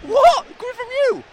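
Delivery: very high squeal of disbelief; high-pitched comic outrage; incredulous. Real, not acted. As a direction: squeak it as if scandalised. Mock shock at co-host's advice.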